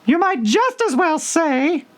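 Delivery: very high, slow squeaky mouse; high drowsy dormouse voice; sleepy Dormouse. Acted. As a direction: talk in your sleep as a tiny mouse. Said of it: "added the Dormouse".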